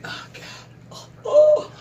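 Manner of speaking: mock despairing groan jumping way up; a hammed-up anguished cry; exasperated groan. Vlogger's mock despair at a clueless millennial, high anguished oh.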